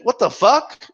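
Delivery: ordinary talk, nothing marked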